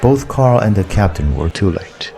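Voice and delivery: ordinary delivery, nothing marked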